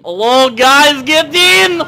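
high, loud holler to passengers; loud, rising summons; calling out. Pretend. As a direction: holler them into the car. Calling characters into the car.

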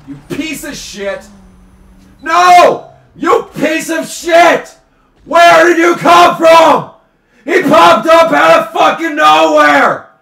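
high, loud screaming rage; raging at a rival car that came from nowhere; furious shout. Real, not acted. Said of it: "whenever I shout".

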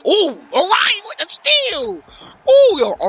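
loud, high, drawn-out yell; excited shout. Real, not acted. Commentator shouting as Orion steals ball. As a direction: scream the steal like it's huge.

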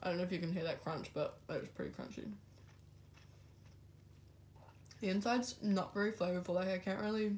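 talking between bites of food; chewing while talking. Real, not acted. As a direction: mouth partly full of rice. Seen 3: Holds bitten onigiri, cheeks full, chewing between bites while talking.

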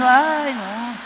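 ordinary talk

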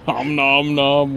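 slow mock munching noises; greedy smacking while eating. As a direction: om nom nom, slow and hammy. Mocking the chip eating.

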